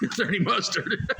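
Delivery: words broken by real laughter; cracking up while repeating the punchline; laughing. Real, not acted. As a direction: laugh through the question.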